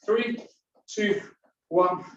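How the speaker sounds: ordinary talk, nothing marked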